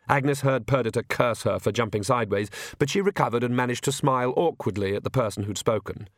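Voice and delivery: ordinary delivery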